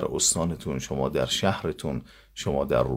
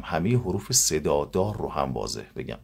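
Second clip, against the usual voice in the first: normal talking voice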